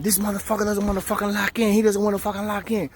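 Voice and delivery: high-pitched imitation of a nonstop rant; mocking ranting woman. Officer complaining to police about an inmate, mock ranting. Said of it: "just running her mouth, going crazy".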